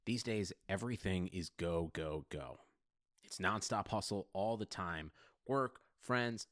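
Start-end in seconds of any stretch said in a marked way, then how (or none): none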